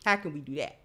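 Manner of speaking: normal talking voice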